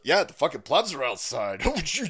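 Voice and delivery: normal talking voice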